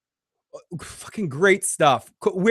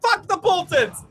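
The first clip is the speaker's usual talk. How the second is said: high, frantic cursing; freaking out; 11 semitones higher than usual. Real, not acted. Cursing at the trailer in high excitement. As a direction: rant at the screen. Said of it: "apologize for my freaking out in there".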